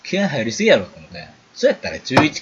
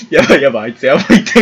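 laughing alarm; 8 dB louder, faster than usual. Retold or imitated. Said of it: "boys laughing at the mockery".

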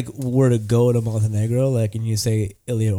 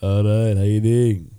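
put-on lower-pitched Montenegrin accent; put-on Montenegrin accent, deeper; Ilya Orlovich; 5 semitones lower, slower than usual. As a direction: greet like a Montenegrin boss. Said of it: "Ilya Orlovich".

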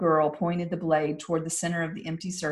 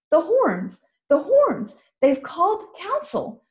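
put-on high, urgent girl's cry; young girl hearing the horns; alarmed girl; 11 semitones higher, 5 dB louder than usual. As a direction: shout it out like a startled girl.

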